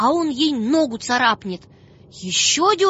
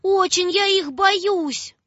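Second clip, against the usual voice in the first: put-on high, breathless scared girl; frightened child; 7 semitones higher than usual. Маша вбегает домой к папе, high frightened child. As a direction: rush it out in fear. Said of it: "кричит".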